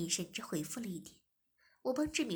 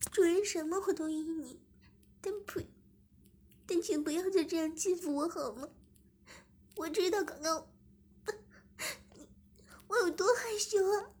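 crying; 8 semitones higher, 5 dB louder than usual. Acted. Zhimin cries to the master not to shame her in public, high tearful plea. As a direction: plead through sobs, high. 志明 pleads.

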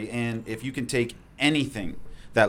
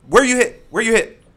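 high loud urgent questions; urgent shout; 13 semitones higher, 12 dB louder than usual. Retold or imitated. Medic shouting at an injured man, urgent. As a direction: demand it fast and loud. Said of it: "I just skip".

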